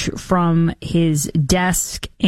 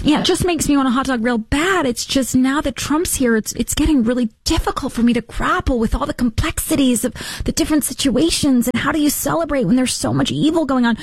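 higher-pitched parody of moral agonizing; mock anguished hand-wringing; 6 semitones higher than usual. Host mocking people who can't celebrate the country, mock anguish. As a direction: mimic someone making a show of being conflicted.

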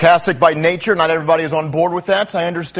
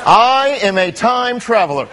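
mock time traveler. Sarcastic time traveler reply.

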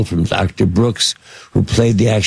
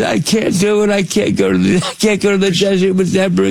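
mock crying plea; 9 semitones higher than usual. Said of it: "I actually think I started crying".

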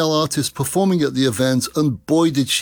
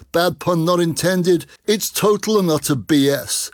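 normal talking voice

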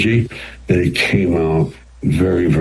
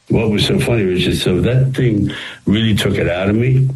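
usual speaking voice

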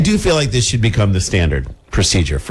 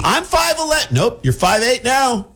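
high mock voice; 12 semitones higher than usual. Mock cop shrinking height.

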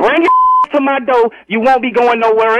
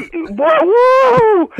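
ordinary delivery

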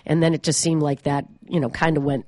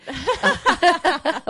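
burst of laughter; 12 semitones higher than usual. Real, not acted. Laughing about the move.

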